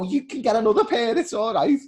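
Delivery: high, loud breezy brush-off; a hint of a cocky teammate shrugging off taking the boots; cheeky teammate. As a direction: shrug it off like it's nothing. Teammate's cheeky reply voiced.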